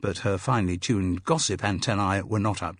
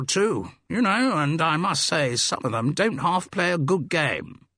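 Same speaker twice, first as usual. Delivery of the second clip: jovial public school voice, often pitched up; hearty Mike, cricket enthusiast; 5 semitones higher than usual. Acted.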